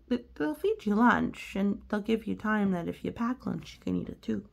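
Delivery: usual speaking voice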